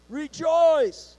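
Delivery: high, loud "Rejoice!" with full force; emphatic shout. Shown as a demonstration. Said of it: "You've gotta put some emphasis".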